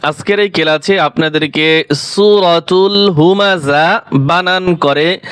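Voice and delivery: usual speaking voice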